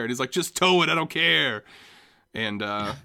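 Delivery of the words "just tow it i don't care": loud, high brush-off; careless shout. Retold or imitated. Jack yelling at the parking attendant, loud dismissive. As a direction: shout it over your shoulder.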